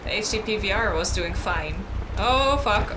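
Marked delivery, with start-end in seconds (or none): 2.2-2.9 sudden loud swear; alarmed curse. Real, not acted. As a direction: blurt it out in alarm. Game crashes again.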